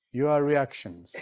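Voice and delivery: normal talking voice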